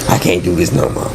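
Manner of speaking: ordinary delivery, nothing marked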